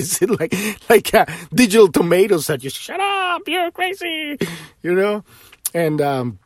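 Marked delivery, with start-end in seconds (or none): 2.7-4.4 mocking dismissers. Mocking people dismissing him.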